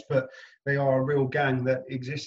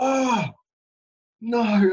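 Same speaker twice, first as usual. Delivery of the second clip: high, louder "Oh, no" of horror; mock dismay; 9 semitones higher, 7 dB louder than usual. Actor finding his character gets shot, mock-horrified and loud. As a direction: wail it in hammed-up despair. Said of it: "I was like".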